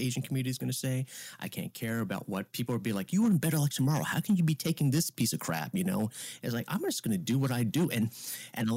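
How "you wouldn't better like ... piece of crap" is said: higher, pushed incredulous scolding; exasperated mock disbelief, pitched up; mock indignant critics. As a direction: imitate outraged onlookers scolding. Voicing critical people, retold.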